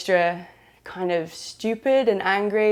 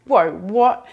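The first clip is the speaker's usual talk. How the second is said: retold surprise; 7 dB louder than usual. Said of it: "we're like".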